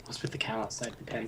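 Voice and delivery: whispering. Pretend. Hiding from guards, whispering.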